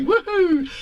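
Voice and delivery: high, loud whoop of delight; whooping cheer. Retold or imitated. Host reads viewer's happy comment, whooping cheer. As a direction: let out a big whoop, pitched right up.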